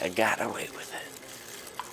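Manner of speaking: slow, hushed, half-whispered; re-enacted secret little triumph; retold gloating to oneself. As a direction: whisper it slowly to yourself in disbelief. Drunk rider's private gloating, retold.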